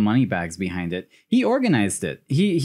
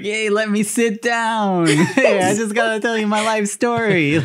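jokey long-winded guest; mock chummy visitor; 8 semitones higher than usual. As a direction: act breezily overfamiliar, pitched up. Imagines Milo being cheekily overfamiliar.

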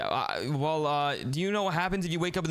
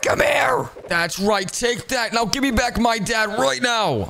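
shouting; 6 semitones higher, 9 dB louder, slower than usual. Acted. Demanding dad back in fight.

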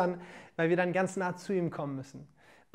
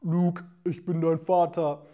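deep, slow movie-villain voice; booming Subwoofer voice; 5 semitones lower than usual. Retold or imitated. Preacher mocks the idea of God speaking, deep booming voice. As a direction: boom it out like a heavenly bass speaker. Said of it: "eine fette Subwoofer-Stimme".

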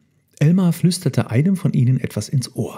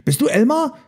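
high questioning elephant voice; puzzled question; 14 semitones higher than usual. A narrator's reading. Confused elephants ask one another, high puzzled voice.

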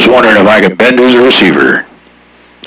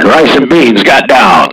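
ordinary talk, nothing marked

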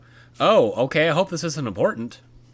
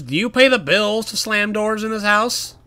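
mock parent; 8 semitones higher, 4 dB louder than usual. Scolding a character slamming doors.

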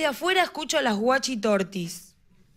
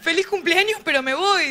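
mock yell; 6 semitones higher than usual. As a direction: cheer it like a relative killing the lights. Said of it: "otro que apaga la luz y grita". (grito de fiesta, agudo y fuerte)

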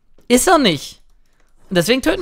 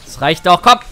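normal talking voice

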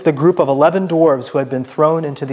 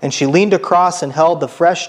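ordinary delivery

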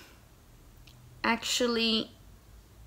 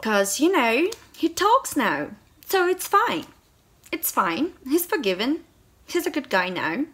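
high, loud mocking sing-song; mock the book's excuse with bite; scornful mock cheer, pitched up; sarcastic mockery; 5 semitones higher, 4 dB louder than usual. Real, not acted.

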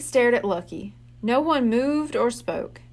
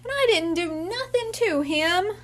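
a lightly voiced wailing little kid, higher; child wailing; 8 semitones higher than usual. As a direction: whine the complaint like a small child. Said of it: "the kid wailed".

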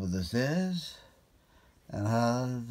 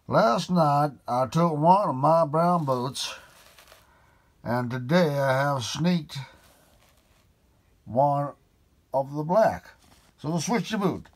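higher, louder telling of the boot swap; indignant Sir Henry; 5 semitones higher, 5 dB louder than usual. Acted. Sir Henry explaining the boots to Holmes, annoyed. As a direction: push the story out with rising annoyance.

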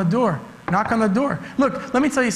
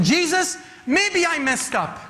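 pleading father; 5 semitones higher than usual. Acted. Father voiced pleading to Jesus.

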